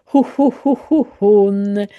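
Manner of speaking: "ho, ho, ho, ho" in short repeated puffs, then "hon"; H sound drill. Shown as a demonstration. Teacher has learners get used to the sound, repetitive H drill. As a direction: repeat "ho" as a practice drill, landing on a held "hon". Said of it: "säger väldigt många gånger".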